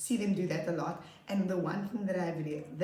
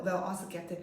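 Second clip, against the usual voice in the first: normal talking voice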